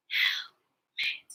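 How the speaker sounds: HELMET whispered in two separate beats; whispered syllables. Shown as a demonstration. Teacher leading children through word parts, Helmet whispered. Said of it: "Whisper with me".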